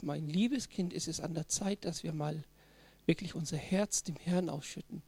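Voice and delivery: lightly voiced sanctimonious parent; mock pious parent. (leicht gespielter frommer Elternton, leise)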